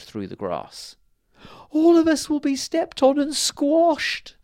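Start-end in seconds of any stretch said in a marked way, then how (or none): 1.7-4.4 very high, loud, slow wail of dread; the queen fearing they'll all be crushed; frightened cry. A narrator's reading. Fairy queen sees a Bigfoot coming: very high, loud frightened cry. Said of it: "cried the fairy queen".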